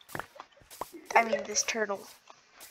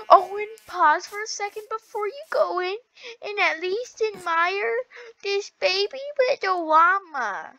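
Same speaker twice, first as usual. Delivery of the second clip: gushing; 8 semitones higher than usual. Real, not acted. Cooing over baby llama.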